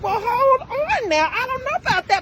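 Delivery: high, slow and drawn out, half-joking "hold on now"; mock hesitant high. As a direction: stall him with a high, slow, doubtful drawl. Cautious parent answering son.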